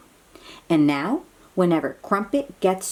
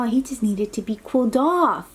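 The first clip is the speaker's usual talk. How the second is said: ordinary delivery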